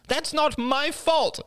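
cried out. Acted. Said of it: "cried Crystal".

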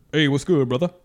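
lightly disguised casual guy; out of mouth corner. Pretend. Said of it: "You wanna give us a".

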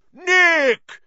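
roaring. Acted. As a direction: bellow his name across the hall. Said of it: "he roared".

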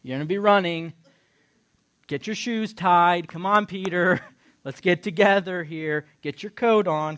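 loud, insistent hustle-along; hurrying angel. Retold or imitated. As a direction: chivvy a sleepy friend along. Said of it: "So he's like".

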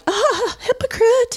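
high, sing-song accusing "hypocrite"; mocking call-out. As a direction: point the finger in a high teasing voice. Imagined calling someone a hypocrite.

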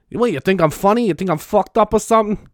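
hammed-up tough guy menace; Judge Judy as Joe Pesci. Judge Judy doing Joe Pesci at the viewer, mock menace. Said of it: "I don't know why she's Joe Pesci".

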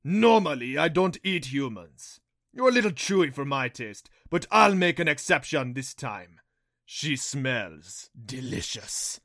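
loud villain, slowing into relish; menacing Rompo beast. A narrator's reading. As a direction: savour the threat, stretching the last words. Rompo threatens to eat mother.

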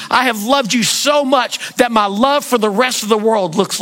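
a swelling shouted declaration of love; impassioned shout. Acted. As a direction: proclaim it at full voice over the room. Preacher voicing God's love.

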